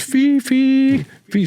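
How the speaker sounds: high, loud sing-song call across the street; calling her dog. Retold or imitated. Neighbor lady calling out for her lost dog, high and loud. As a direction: call the dog's name out like a worried owner. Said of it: "She's calling her dog".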